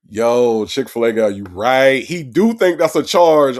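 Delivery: loud, hyped call to the caller; excited shout-out. Real, not acted. Hyped host yelling to the Chick-fil-A guy, loud and excited. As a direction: shout it out, amazed he's right.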